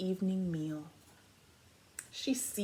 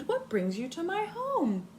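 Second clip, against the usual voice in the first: higher surprised question; exclaimed; 8 semitones higher than usual. Acted. Amu surprised to see the officer, high. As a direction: ask the visitor in wonder. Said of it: "Amu exclaimed".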